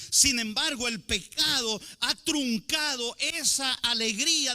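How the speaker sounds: loud, slow, pressing sermon voice; preacher at full force; fervent preaching. Acted. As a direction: hammer the warning home to the brothers.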